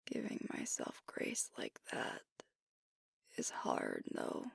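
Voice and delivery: soft close whisper, words barely voiced; intimate whisper. Alpha confessing self-doubt to his partner, in a whisper. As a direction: confide it in a hush, close to the ear.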